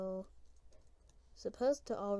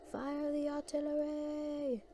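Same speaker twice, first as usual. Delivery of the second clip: high, drawn-out order in a put-on French accent; 6 semitones higher, slower than usual. Player playfully ordering the cannon crew to fire, French accent. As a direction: call it out slowly and high, mock French. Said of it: "I did a French accent".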